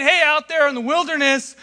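a distant God tossing out a snack, hammed-up; preacher mocking a careless God, high and breezy; mock casual God. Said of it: "he's not saying".

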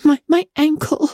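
high, pained gasp; gasping Anne. A narrator's reading. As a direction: push it out through pain. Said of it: "gasped Anne".

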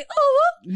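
very high, loud party whoop; celebratory whoop. Real, not acted. Cheering that they graduate, high loud whoop.

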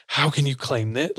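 ordinary talk

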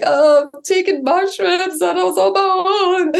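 high, wailing confession, close to sobs; retold crying, high and strained; tearful wail. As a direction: blurt it out through tears, pitched far up. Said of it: "I started crying".

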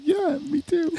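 very high eager squeal; high excited girl voice. Retold or imitated. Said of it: "She's like".

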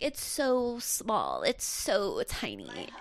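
drawn-out, slow mock whine of complaint; mock celebrity complaint. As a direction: lightly imitate a spoiled star, dragging each phrase. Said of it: "[name] and being like".

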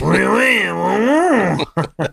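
put-on jokey French accent, laughing along; a playful Frenchman repeating oui; mock French. Co-host riffs on French bit.

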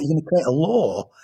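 high-pitched, incredulous repeat of the news; imitating a boy's amazed disbelief; surprised echo. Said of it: "He went, What?".

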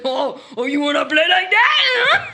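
loud playful threat, laughing; laughing mock threat. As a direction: challenge him, cracking up. Boudreaux retaliating, laughing shout.

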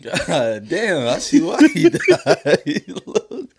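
breaking up into high laughter; amused, voice leaping high with laughs; laughing mock reaction. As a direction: try to get the joke out while cracking up. Mock mourner cracking up over corpse.

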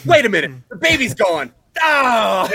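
high, loud startled realisation; sudden alarm. Retold or imitated. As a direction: snap out of it in alarm, then laugh. Acted alarm in retold scene.

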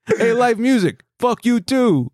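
high-pitched, hammed-up Jersey bro accent; cartoonish Jersey guy telling people off; mock Jersey accent. Host plays a Jersey guy cursing live music, mock accent. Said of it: "Sounds like our Boston accent".

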